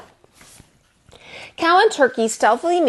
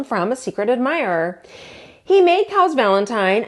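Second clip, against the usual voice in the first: plain reading voice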